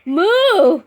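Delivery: laughing moo. Real, not acted. Mom laughs at child's moo.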